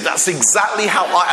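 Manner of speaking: normal talking voice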